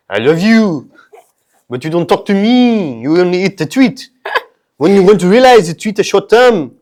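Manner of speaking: put-on loud, high French accent; hammed-up French wooing, pitched high. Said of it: "scared of the French accent".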